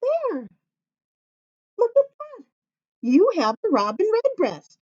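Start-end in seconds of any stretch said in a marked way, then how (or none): 0.0-2.4 high, thrilled show-and-tell; high, wide-eyed wow; delighted reveal. Real, not acted. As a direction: marvel at the finished robin. Showing finished robin to kids.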